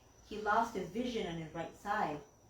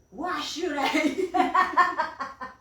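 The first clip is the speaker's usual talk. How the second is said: loud, high, drawn-out grumpy retort; hammed-up indignant comeback; grumpy old man; 8 semitones higher, 11 dB louder, much slower than usual. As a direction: balk at it like a stubborn patient. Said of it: "he was a really grumpy old man".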